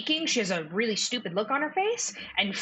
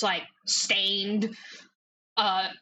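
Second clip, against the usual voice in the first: ordinary delivery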